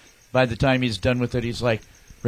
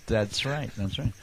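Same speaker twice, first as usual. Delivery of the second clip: ordinary delivery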